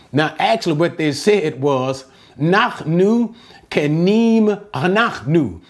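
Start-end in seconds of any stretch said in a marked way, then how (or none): 2.4-5.6 "Nachnu kenim anachnu" slow, each word pressed; emphatic Hebrew. Shown as a demonstration. Brothers' own Hebrew words to Joseph, emphasized. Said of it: "They put emphasis on that".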